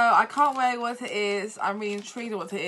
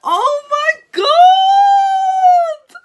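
real overjoyed scream, much higher and louder, slow and drawn out; excited scream; 15 dB louder, much slower than usual. Real, not acted. Unboxing a friend's present, a real joyful scream. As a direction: let the delight burst out as a drawn-out shriek. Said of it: "Sorry, I'm so happy".

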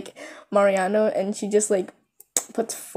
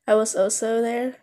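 ordinary talk

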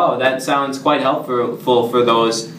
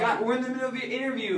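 high, fast, softer scolding; exasperated scolding; 11 semitones higher, 7 dB quieter, much faster than usual. Acted. Interviewer to applicant who dozed off mid-interview, exasperated scolding.

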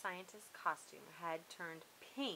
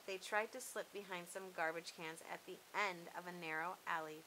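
ordinary delivery

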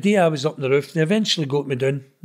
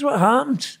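urgent question; 10 semitones higher than usual. Retold or imitated. Asking brother about the sentence.

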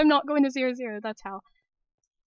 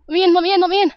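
panicked, loud, high repeated begging; screaming let me in in terror; frantic pleading; 5 semitones higher, 12 dB louder, much faster than usual. Real, not acted. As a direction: beg desperately to be let in.

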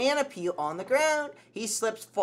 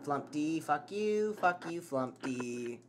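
ordinary talk

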